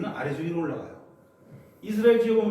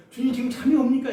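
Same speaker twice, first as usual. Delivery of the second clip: louder reproachful jab; resentful question; 4 semitones higher, 5 dB louder than usual. Acted. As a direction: accuse him of sleeping. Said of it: "원망하고 있습니다". (원망하는 말투)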